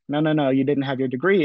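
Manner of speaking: ordinary talk, nothing marked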